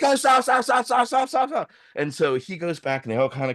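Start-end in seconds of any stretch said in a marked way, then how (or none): 0.0-1.7 high, rapid-fire pleading; mock panic. Border staff halting the phone call, high panicked plea. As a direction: imitate frantic officials begging for a halt. Officials' frantic plea, acted.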